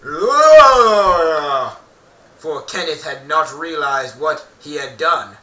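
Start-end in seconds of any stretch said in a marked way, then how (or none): 0.0-1.8 loud roaring yell, higher; scream. Acted. Kenneth turns and roars at the creature. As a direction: bellow it like a beast. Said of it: "Kenneth turned around and screamed".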